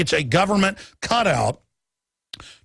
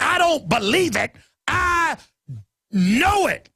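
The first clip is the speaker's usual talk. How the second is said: rising much higher on I, then know; emphatic insistence; 9 semitones higher, slower than usual. Retold or imitated. Answering doubting writers, climbing to a peak on I. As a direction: drive home I know it. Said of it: "And I'm like".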